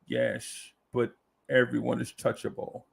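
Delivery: low, soft, slushy-S movie-star impression; hushed, deliberate impersonation of a screen legend; Sean Connery impersonation. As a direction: drop low and quiet, every S turned to sh. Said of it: "That's my Sean Connery impersonation".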